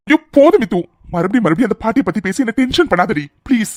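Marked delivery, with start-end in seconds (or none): 0.1-0.8 loud, higher fed-up cry of enough; exasperated outburst. Acted. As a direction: snap it out, sick of hearing it. Exasperated husband cuts her off. (கோபமான சலிப்புடன் சத்தமாக)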